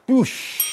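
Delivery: quick hissed "dush" of a burst; bursting noise. Retold or imitated. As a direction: imitate a radiator blowing. Imitates the radiator bursting.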